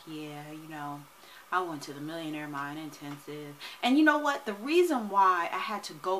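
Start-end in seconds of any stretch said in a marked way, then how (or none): 0.1-3.6 quiet, lower, listless recap; flat and low-energy. Shown as a demonstration. Lazy video recap, mock low-energy. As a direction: mumble it like you don't care. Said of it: "I was doing it like that".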